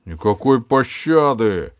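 loud, slow roaring tyrant king; barked. Acted. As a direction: bark the order like a tyrant. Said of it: "рявкнул король". (рявкающий король)

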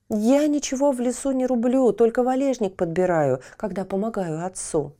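put-on high earnest boy voice; young boy. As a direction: plead your case sincerely. Boy defends himself.

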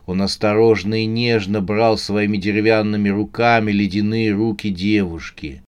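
plain reading voice

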